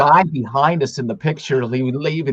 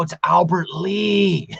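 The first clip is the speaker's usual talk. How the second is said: high, drawn-out fan protest; retold giddy insistence, slow and high; starstruck protest; 7 semitones higher, slower than usual. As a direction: stretch it out high, in awe of the name. Retells his starstruck protest to wife.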